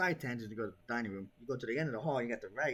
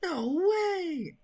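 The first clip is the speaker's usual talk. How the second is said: high loud delighted cry; excited shriek; 8 dB louder than usual. Real, not acted. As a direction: squeal with disbelief. Excited reaction to gift.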